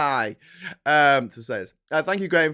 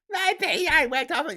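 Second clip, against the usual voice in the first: higher, slow whiny caller; mock caller; 13 semitones higher, slower than usual. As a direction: parody a moaning caller. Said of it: "some people calling in".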